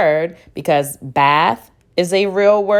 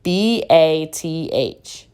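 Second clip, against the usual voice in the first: slow letter spelling; slower than usual. Shown as a demonstration. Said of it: "the first syllable is bath".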